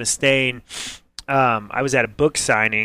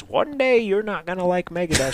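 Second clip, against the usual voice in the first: higher put-on mother's voice; mock mom voice; 5 semitones higher, 4 dB quieter than usual. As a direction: exaggerate a mom's warning, pitched up. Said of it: "She didn't really talk like that".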